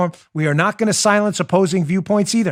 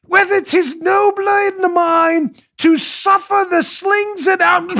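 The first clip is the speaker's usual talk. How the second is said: hammy Hamlet; 11 semitones higher, slower than usual. Acted. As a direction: overact the soliloquy with a crying tremble. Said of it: "a Hamlet scene in the street".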